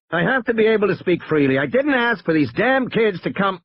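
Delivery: high-pitched, exasperated complaint. A narrator's reading. Lawyer objecting to children listening in, exasperated. Lawyer angry at being overheard.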